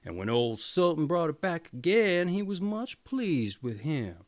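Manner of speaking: ordinary delivery, nothing marked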